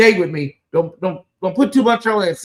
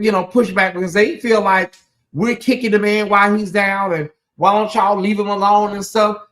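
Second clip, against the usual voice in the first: usual speaking voice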